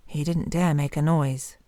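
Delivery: ordinary delivery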